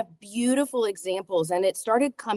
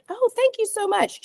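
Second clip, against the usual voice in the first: high thank-you; 13 semitones higher than usual. Retold or imitated. Acted reply to compliment, high.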